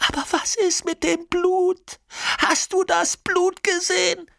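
sehr hoch und laut, außer sich; hysterischer Schrei. A narrator's reading. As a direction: kreisch die Frage panisch heraus. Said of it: "schreit Nina". (schrill aufgelöst schreiend)

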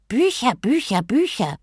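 mocking. A narrator's reading. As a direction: ape him with scornful repetition. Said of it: "äffte ihn der Dschinn nach". (spöttisch nachäffend)

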